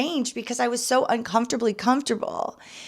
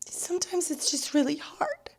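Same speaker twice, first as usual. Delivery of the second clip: barely holding back tears; quiet, tearful admission; real tears; 6 semitones higher, 6 dB quieter than usual. Real, not acted. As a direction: voice choked with real emotion, much softer. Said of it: "getting really emotional".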